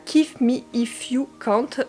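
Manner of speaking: title read in a French speaker's English accent; self-mocking English accent on the title. Real, not acted. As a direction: say Kiss Me If You Can't with her put-on English accent. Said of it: "mon accent anglais".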